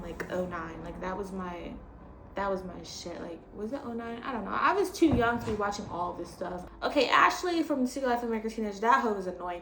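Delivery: ordinary talk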